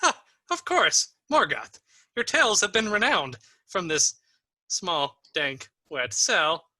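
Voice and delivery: put-on high, swooping old man's voice; theatrical old prisoner with a chuckle. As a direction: play a chatty old cellmate, pitched high.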